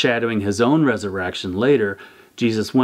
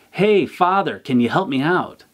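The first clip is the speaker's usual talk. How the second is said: higher call up out of a hole; mild retold yell; 6 semitones higher than usual. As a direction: call up to the passing priest, half-yelled. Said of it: "The guy yells up".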